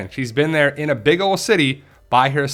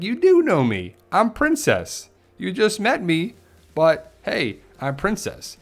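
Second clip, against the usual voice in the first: put-on much higher, slowed sing-song girl voice; bubbly Princess; 8 semitones higher, slower than usual. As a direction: play the quirky girl, way up high. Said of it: "And Princess is like".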